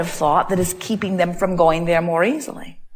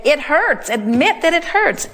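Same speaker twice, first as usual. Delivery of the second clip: higher, pressing each hurts; insistent urging; 7 semitones higher than usual. Acted. Urging a person in pain to admit it, insistent. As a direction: push them to admit it hurts.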